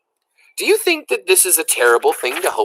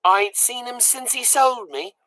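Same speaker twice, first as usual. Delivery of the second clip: put-on high, rough little servant girl; a plucky peasant child speaking up; high-pitched country girl; Mig's high girl voice; 8 semitones higher than usual. A narrator's reading. Mig voiced in story.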